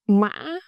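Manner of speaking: "mã" broken mid-vowel, then rising; "mã" in the broken ngã tone. Shown as a demonstration. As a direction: catch "mã" in the middle, then lift it. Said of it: "is broken raising".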